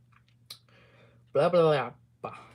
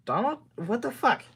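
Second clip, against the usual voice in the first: surprised; 10 semitones higher, 3 dB louder than usual. Real, not acted. Surprise at the text.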